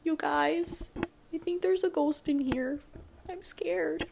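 high, small frightened voice; mock scared. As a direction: pretend to be spooked by a ghost.

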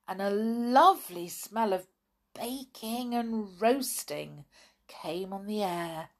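plain reading voice

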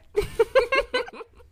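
bright burst of laughter, pitch up; high laugh. Real, not acted. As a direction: laugh out loud in amusement. Laughs at the killer's mistake.

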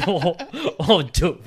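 laughing out the words; laughing in disbelief. Real, not acted. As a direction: blurt it through laughter. Laughing reaction to friend.

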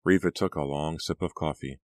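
ordinary delivery

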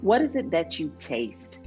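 ordinary talk

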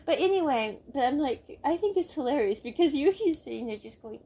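normal talking voice

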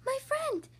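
very high little creature voice; tiny faint voice. A narrator's reading. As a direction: pipe it high, like someone tiny. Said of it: "The voice was so faint".

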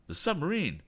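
high-pitched cry of alarm; urgent lookout's call; alarmed shout. A narrator's reading. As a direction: shout out the danger. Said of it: "he shouted".

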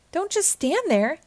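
calling over to the friends. Acted. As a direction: hurry them along. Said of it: "he called to Sandy and Patrick".

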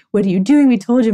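loud, exasperated mock telling-off; imitated scolding. Nurse telling off her stubborn dad, mock scolding. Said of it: "bitched my dad out".

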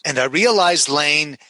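usual speaking voice

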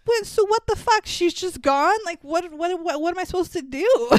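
high-pitched, flustered disbelief; mock distraught. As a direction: act out a helpless, frantic plea. Retold distraught sister losing Lilo.

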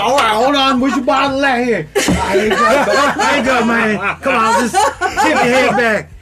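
laughing. Retold or imitated. Mock offering a drink, laughing.

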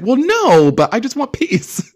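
very high, loud mock wail; shrieky imitation of pleading; mock exasperated protest. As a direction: squeal it in hammed-up protest. Voices Teresa protesting to Jennifer.

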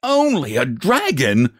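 high, slow, incredulous echo; alarmed father's exclamation; indignant cry. A narrator's reading. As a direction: throw it back in disbelief. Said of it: "cried his father".

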